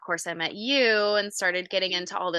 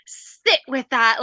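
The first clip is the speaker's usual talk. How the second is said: slow, slightly raised imitation of the trainer's accent; imitated accent of Lockie; 4 semitones higher, 5 dB louder, slower than usual. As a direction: lightly put on his accent, drawing the words out. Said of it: "in his little perfect accent".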